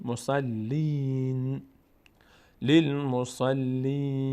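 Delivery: "مسلین" with a slow, stretched ee; long ee vowel. Shown as a demonstration. Teacher joins syllables for learners, long ee. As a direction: draw out the ee in "مسلین" before the N. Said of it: "یا، صدای ای".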